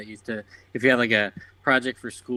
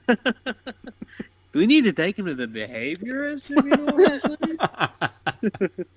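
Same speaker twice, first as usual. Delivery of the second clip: laughing parent voice; 12 semitones higher than usual. Retold or imitated. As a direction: imitate a parent while laughing.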